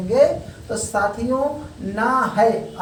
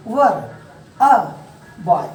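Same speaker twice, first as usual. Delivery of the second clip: British-style "were" as a short wur; word-by-word British-style demo, with pauses; British phonetic; 3 semitones lower, 5 dB louder, much slower than usual. Shown as a demonstration. Teacher shows students British phonetics, British-style demo. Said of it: "यही British phonetic में क्या होगा".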